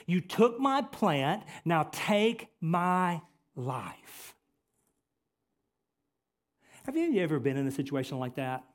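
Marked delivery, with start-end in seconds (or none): 0.1-4.3 slow, drawn-out plea to God; slow, deliberate acted plea; Jonah's plea. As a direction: stretch out each word as Jonah pleading. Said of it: "Jonah turns to God and he says".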